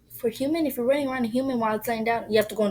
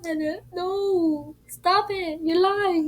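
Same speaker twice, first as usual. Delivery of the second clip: panicked; 8 semitones higher, 6 dB louder, much slower than usual. Retold or imitated.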